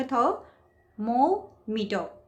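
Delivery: "Thermometer" very slow, split up, मो stretched and stressed. Shown as a demonstration. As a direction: pause before a long, weighty मो in "thermometer". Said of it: "मो पे stress डाला".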